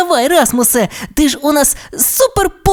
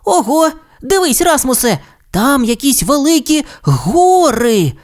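amazed exclamation; 11 semitones higher than usual. Acted. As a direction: marvel like an astonished little dinosaur. Timmy marvels at the mountains.

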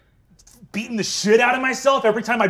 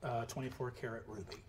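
ominous hush; 10 semitones lower, 20 dB quieter than usual. Acted. Ominous whispered reveal.

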